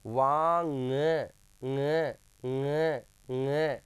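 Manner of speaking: वांग drawn out very slowly, then ng repeated as a nasal nga; nasal pronunciation demo. Shown as a demonstration. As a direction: stretch वांग, then drill ng through the nose. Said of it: "इसका उच्चारण कहाँ से होता है". (वांग को खींचें, फिर ng को नाक से "ङ" दोहराएँ)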